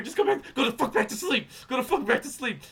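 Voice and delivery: loud, high, laughing exasperation; exasperated plea. Retold or imitated. Nervous son wishing his father back to bed, exasperated.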